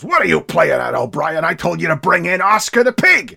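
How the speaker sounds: police chief yelling at his officer; angry chief. Retold or imitated. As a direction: tear into the officer, gruff and indignant. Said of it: "Fuhrer gets all up in his face and goes".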